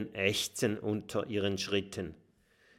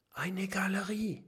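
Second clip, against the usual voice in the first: high breathy whisper of awe; awed whisper in a dark gallery; 11 semitones higher, 4 dB quieter than usual. A narrator's reading. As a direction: whisper it in wonder. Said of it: "flüstert Mathilda".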